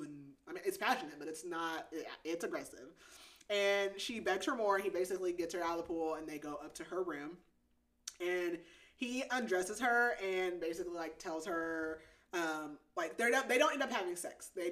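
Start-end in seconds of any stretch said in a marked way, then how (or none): none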